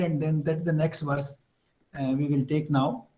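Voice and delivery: ordinary delivery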